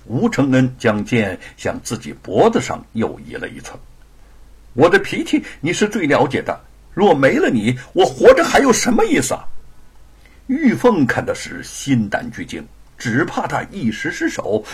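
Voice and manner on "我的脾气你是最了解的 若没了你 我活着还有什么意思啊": raised, pleading man's voice; desperate husband. Acted. Husband threatens wife with sword, desperate pleading. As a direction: plead with the sword at his own throat.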